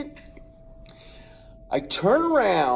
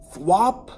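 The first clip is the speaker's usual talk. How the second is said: thwop sound; 3 semitones higher, 6 dB louder than usual. Retold or imitated. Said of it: "I heard this sound like this".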